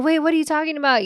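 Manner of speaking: high, loud, fast protest; mock indignant. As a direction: fire it back, offended. Retold argument with boyfriend.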